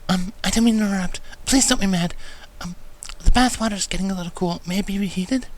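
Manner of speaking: timid Fluttershy. A narrator's reading. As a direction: apologize for interrupting, shyly. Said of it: "in her typical timid fashion".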